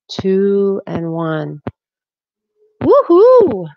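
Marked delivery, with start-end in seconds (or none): 2.8-3.7 loud high whoop; excited cheer. Real, not acted. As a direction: cheer like the set is done. Cheering at end of warmup.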